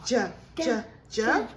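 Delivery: loud, slow letter sounds. Real, not acted.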